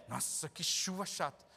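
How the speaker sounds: low, slow soft grumble; whiny complainer. Retold or imitated. Preacher mocks a believer grumbling about rain, low slow whine.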